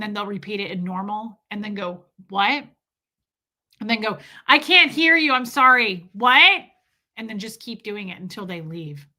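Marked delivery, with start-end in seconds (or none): 4.5-6.1 high, loud mock deafness; getting louder. Showing how to wear down a customer, loudly feigning deafness. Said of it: "And then get louder". 6.1-6.6 loud, high mock-deaf query; getting louder. Coaching a worker to annoy customers, very loud feigned deafness. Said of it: "And then get louder".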